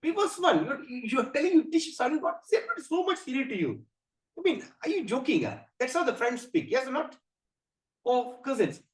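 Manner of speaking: a hint of a cousin ribbing a friend; casual friend. Arjuna imagined talking to his cousin Krishna, mild casual teasing. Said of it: "He could have just said".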